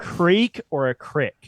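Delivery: "creek" with long EE, then "crick" with short I; two pronunciations. Shown as a demonstration. Asking which pronunciation is right, vowels contrasted.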